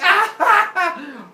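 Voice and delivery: loud, high comic yelping; mock pain scream. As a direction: yelp as if suddenly cut, hammed up. Father Sky cries out when cut.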